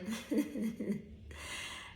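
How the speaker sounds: breathy laugh. Real, not acted. Laughs after.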